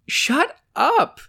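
high-pitched exclaimed interruption; acted indignant outburst; cutting in. A narrator's reading. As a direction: cut the joke off sharply. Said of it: "Lilla cut in on his joke".

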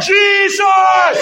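high, loud, drawn-out cry; long ringing preacher's shout. Shown as a demonstration. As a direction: shout the name for the congregation to follow. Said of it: "Somebody shout".

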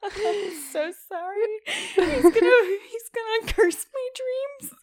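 laughing. Real, not acted. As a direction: giggle through the apology. Laughing apology to Ötzi.

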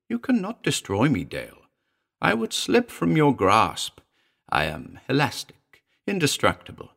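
high, swooping creature voice; shrill little demon. A narrator's reading. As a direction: taunt him shrilly, dropping away on his name. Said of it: "The little shape shrilled".

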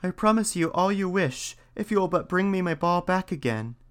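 put-on high, bright-eyed young princess. A narrator's reading. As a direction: lift it up into a girlish princess. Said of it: "said she".

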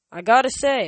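loud, breezy and high; casual Apollo voice. A narrator's reading. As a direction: toss it off like a cocky god. Apollo breaks silence casually.

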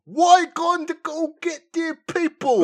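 loud, high, slow hammy British; exaggerated British accent. Co-host mocking Mira's epilogue accent, hammy British shout. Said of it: "slightly British accent in one".

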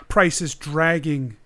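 ordinary talk, nothing marked

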